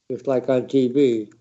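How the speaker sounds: ordinary delivery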